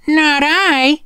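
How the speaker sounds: high, loud cat voice; a prim little cat saying no. A narrator's reading. Cat refuses.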